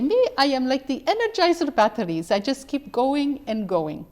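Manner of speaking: imitating mother's Dutch accent, proud; elderly mother's cheerful Dutch-accented boast; little Dutch accent. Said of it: "in her little Dutch accent".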